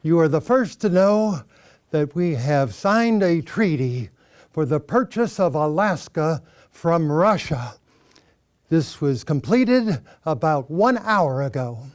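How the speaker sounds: loud, formal statesman address; elder statesman. Acted. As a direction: proclaim it like a secretary of state. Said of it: "the Honorable William Henry Seward".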